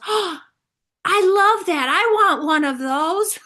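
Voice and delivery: put-on an eager little boy's voice; delighted little boy. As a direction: squeal it like a small kid who just heard great news. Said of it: "He goes".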